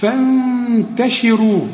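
slow recited فانتشروا with lingering ghunna; nasal ghunna. Shown as a demonstration. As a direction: hold a long nasal hum on the noon of فانتشروا. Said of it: "هنا محتاجة غنة". (غنة طويلة على نون فانتشروا)

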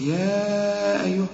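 "يا" drawn out long and steady before "أيها"; slow recitation, stretching "يا"; four-count madd. Shown as a demonstration. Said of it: "وحنمد على حسب القراءة بتاعتنا أربع حركات".

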